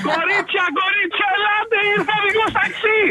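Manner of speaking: high-pitched, thrilled summons, laughing through it; excited calling out. Retold or imitated. Receptionist calling her colleagues over to the taxi driver, excited.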